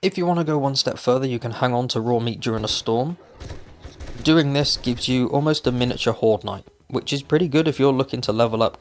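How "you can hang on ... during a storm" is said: usual speaking voice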